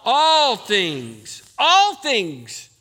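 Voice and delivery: loud emphatic preaching with ALL punched; loud, firm declaration, stressing all; loud, like you mean it. Shown as a demonstration. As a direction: proclaim it out loud with conviction. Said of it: "say that out loud like you mean it".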